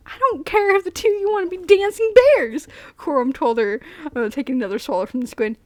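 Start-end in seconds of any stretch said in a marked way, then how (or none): 0.1-2.7 high, loud, blustering retort; acted indignant dismissal, loud and raised; exasperated outburst. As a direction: bluster it out, pitched way up. Acted quarrel in story.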